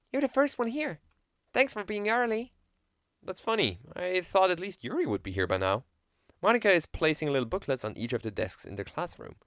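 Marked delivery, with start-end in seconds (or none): 0.1-5.8 a higher, friendly club president voice; Monika's voice. Acted. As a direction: greet him brightly, a touch higher. Plays Monika greeting him.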